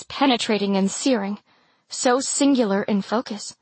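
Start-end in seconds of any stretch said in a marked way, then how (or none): none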